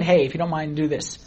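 put-on soft, low ask of a child; gentle request to kid. Dad softly asking his kid to do something. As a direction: keep it soft and polite, almost murmured. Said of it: "like me telling my kid".

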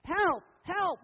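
high, loud cries for help; hammed-up high-pitched yell; mock screaming. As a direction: play a sheep yelling for rescue. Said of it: "Sheep started screaming again".

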